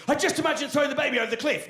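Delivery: high, fast, alarmed blurt; horrified realisation. Shown as a demonstration. Parent confessing an unwanted thought, alarmed. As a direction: blurt it out, shocked at your own thought. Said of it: "Very different thought, right?".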